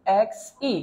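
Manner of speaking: এক্স ই said as the letter name, starting on the vowel; slow careful letter name এক্স ই; pronunciation demo. Shown as a demonstration. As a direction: spell out এক্স ই to show the vowel comes first. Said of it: "এক্স কে আপনি উচ্চারণ লেখেন তাহলে কেমন হবে".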